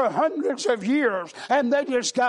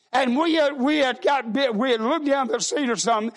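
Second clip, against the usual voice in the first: ordinary talk, nothing marked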